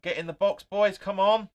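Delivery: loud, high urging yell at the screen; shouting encouragement. Real, not acted. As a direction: roar the team forward. Urging players on, live match.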